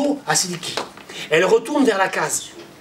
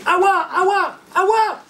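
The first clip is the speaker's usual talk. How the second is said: calling out; 9 semitones higher than usual. Acted. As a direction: cry her name out far and wide. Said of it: "il se met à crier".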